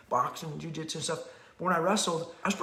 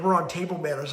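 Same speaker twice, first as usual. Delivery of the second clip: ordinary talk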